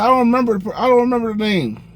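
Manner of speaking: high, stammering, dodging the question; high flustered fib; nervous liar voice. Retold or imitated. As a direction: squeak out an excuse. Caught lying to the manager.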